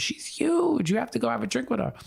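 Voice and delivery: very high, fast "She's huge", urgent; excited Italian aunt. Retold or imitated. Aunt urging nephew to meet the star, excited. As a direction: push him to go, bursting with excitement. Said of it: "She goes".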